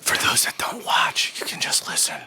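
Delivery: slow unvoiced whisper into the ear; ASMR whisper. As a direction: whisper slowly, right in the ear. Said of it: "we're talking in your ear".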